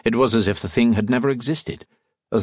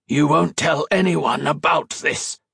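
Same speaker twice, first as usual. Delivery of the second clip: slow, raised villain voice; menacing Gibbons; 6 semitones higher, slower than usual. A narrator's reading. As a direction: draw it out as a strained threat.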